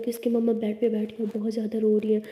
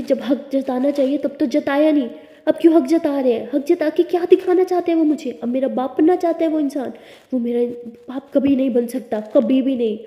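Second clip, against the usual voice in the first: angry outburst; 3 semitones higher, 6 dB louder than usual. Acted. Tara rages about her father.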